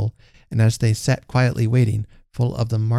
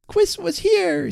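put-on very high, drawn-out childish announcement; excited little boy; slower than usual. A narrator's reading. Hugh telling his mother, excited little boy. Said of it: "said Hugh".

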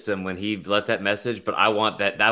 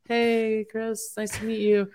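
mock polite greeting; 13 semitones higher, 3 dB quieter, slower than usual. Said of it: "that were like".

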